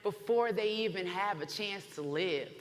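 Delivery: loud, slow, ringing proclamation; preaching at full force. Real, not acted. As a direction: preach it to the back row. Rally speech at full force.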